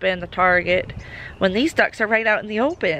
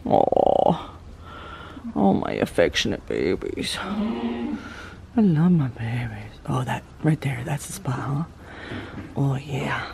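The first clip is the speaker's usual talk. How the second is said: tender cooing; 4 semitones lower, slower than usual. Real, not acted. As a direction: melt over the babies while petting. Petting the goats tenderly.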